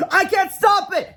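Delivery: very high, slow, loud wail; panicked cry. A narrator's reading. Madison before the soaked crowd, panicked cry. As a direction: cry out as the sprayers go wild. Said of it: "she cried".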